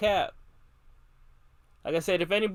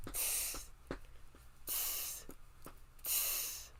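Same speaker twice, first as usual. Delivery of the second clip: breathy whispered tss sounds; whispered monkey tss; 18 dB quieter, much slower than usual. A narrator's reading. Monkeys mocking the peddler back with whispered tss. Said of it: "and says".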